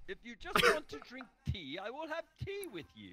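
high, gentle put-on voice; wizard voice. Gandalf offering the Balrog tea, high voice. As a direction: make peace like a kindly old wizard.

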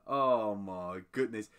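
ordinary talk

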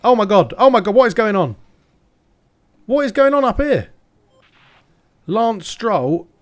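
loud, high, disbelieving "oh my god"; real outburst as the race falls apart; exasperated shouting. Real, not acted. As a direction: yell at the chaos unfolding on track. Crash chaos ahead in race.

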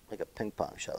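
soft mutter under the breath; quiet mutter. Real, not acted. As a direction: say "Like a ping pong shot" quietly to yourself. Muttering repeated coach's phrase.

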